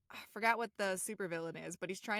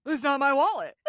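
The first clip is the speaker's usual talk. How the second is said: much louder stubborn denial; loud mock protest; 6 semitones higher, 15 dB louder than usual. Retelling SpongeBob, Patrick loudly refusing the wallet. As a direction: belt out the refusal.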